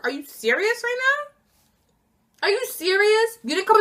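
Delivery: high, drawn-out are you serious; disbelieving. Real, not acted. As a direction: stretch it out in total disbelief. Disbelief at coworker skipping work.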